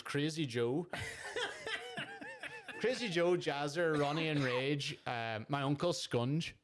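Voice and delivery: reeling off nicknames, amused; laughing list. Real, not acted. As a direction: relish each nickname through laughter.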